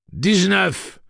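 loud, high shouted answer; urgent projected call; called through door. A narrator's reading. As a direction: yell it through a closed door. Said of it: "dit Eddie à travers la porte".

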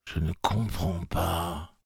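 quiet, slow and dignified. A narrator's reading. The woman answering Eddie, quiet and dignified. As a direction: say it with strange dignity, softly. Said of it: "avec une dignité étrange".

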